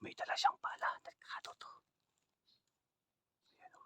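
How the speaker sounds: unvoiced urgent whisper; whispering while hiding. Real, not acted. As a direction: whisper the plan to hide. Hiding from man with shovel.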